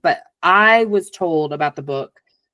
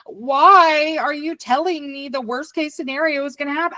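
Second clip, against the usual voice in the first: exasperated; 10 semitones higher than usual. Retold or imitated. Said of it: "And I'm like".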